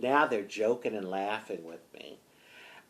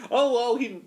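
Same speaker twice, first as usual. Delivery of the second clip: high, loud, excited exclaiming; like little boys; 12 semitones higher, 8 dB louder than usual. Retold or imitated. Officers thinking the boyfriend called back, boyishly excited. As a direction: blurt it like an eager little boy. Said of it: "like little boys".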